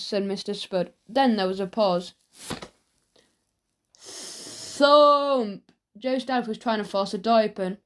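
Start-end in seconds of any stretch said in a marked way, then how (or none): 4.0-5.7 high, drawn-out "thump" sound effect; long, raised storyteller's thump; acted thump. A narrator's reading. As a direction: voice the blow on the door. Acting out blows on door.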